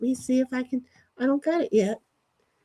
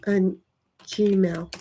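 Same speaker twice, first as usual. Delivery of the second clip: ordinary talk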